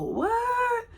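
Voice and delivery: one high, stretched "what?"; shocked what. Retold or imitated. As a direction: play the friends' stunned reply. Friends react to pregnancy news.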